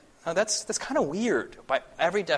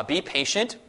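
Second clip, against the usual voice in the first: normal talking voice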